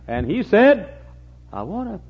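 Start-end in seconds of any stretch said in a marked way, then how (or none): 1.5-2.0 hushed, higher put-on voice; softly disguised king's voice. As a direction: speak softly as if hiding who you are. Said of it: "he disguised his voice".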